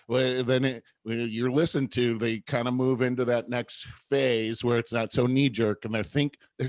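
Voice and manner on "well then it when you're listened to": normal talking voice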